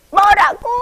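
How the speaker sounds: high, loud whiny "what?"; shrill demand from a sobbing kid; crying child asking. Retold or imitated. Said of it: "이렇게".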